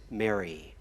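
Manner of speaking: a soft whispered name, barely voiced; tender whisper. Jesus calling Mary by name at the tomb, whispered softly. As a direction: breathe the name gently like a call.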